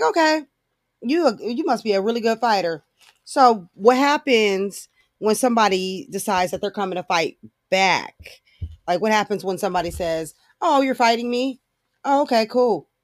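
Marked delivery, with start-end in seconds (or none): 0.0-2.8 high-pitched sarcastic concession; sarcastically awed reply; mock impressed. 10.6-12.9 higher-pitched feigned surprise; sarcastic "oh, really?" comeback; mock nonchalant challenge. Acting opponent answering a challenge.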